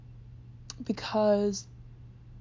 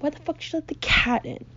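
mock outrage with the pitch pushed up; retold pissed-off; 7 semitones higher than usual. Pissed about the cat running loose, retold exasperation. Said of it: "and I'm pissed".

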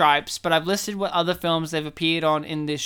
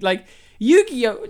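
normal talking voice

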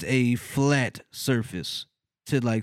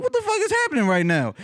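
high, rushed, laughing disbelief; mock panic through a laugh; retold panic; 14 semitones higher, faster than usual. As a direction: squeal it in comic alarm.

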